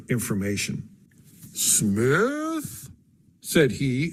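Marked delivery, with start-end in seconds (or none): 1.5-2.9 stretched, lingering one-word question, pitched high; drawn-out syllables. A narrator's reading. Friend Theodore answering the narrator, drawing the word out. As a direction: savour the name as if tasting it. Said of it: "in his well-known peculiar way of drawing out his syllables".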